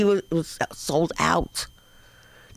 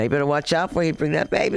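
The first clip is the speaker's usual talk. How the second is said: normal talking voice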